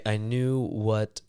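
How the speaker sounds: normal talking voice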